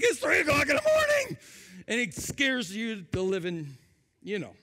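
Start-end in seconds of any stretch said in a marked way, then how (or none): 0.0-1.4 high, loud startled yelp; startled shout. Retold or imitated. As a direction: jolt awake and yell it high. Startled parent woken at night.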